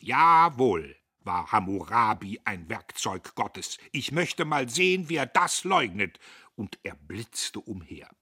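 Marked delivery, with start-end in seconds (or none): 0.0-6.2 loud, solemn declaration; grave conviction, voice raised; deeply serious. A narrator's reading. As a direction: challenge anyone to deny it. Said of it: "Diederich war tief ernst".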